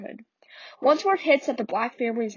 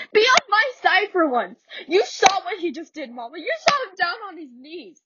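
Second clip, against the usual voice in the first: Beneatha pleading; 8 semitones higher than usual. Acted. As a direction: beg Mama to take her side. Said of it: "I'm not good at accents".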